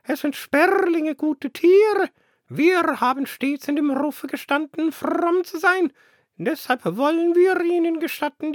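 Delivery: high, loud, preachy bird voice; pompous dove. Acted. Smug dove explains the sparrows to the flock, high preachy voice. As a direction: preen and sermonize like a smug dove.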